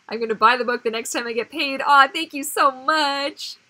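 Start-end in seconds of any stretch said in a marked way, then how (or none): 1.8-3.6 loud, higher, drawn-out gratitude; touched and effusive; gushing thanks. Real, not acted. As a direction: gush it slowly, stretching the words.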